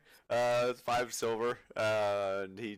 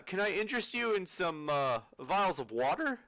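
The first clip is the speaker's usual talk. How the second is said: upselling shopkeeper; 8 semitones higher than usual. Acted. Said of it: "he's trying to upsell you".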